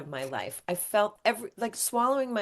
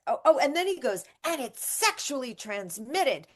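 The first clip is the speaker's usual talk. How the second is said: mimicking the doctor tacking on an awkward remark, higher; 6 semitones higher than usual. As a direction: lightly voice the doctor blurting it out. Doctor voiced adding the shock.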